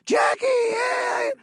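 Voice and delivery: fan yelling out. Retold or imitated. A fan spotting Jackie, yelling out excitedly. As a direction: shout his name across the street. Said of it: "and be like".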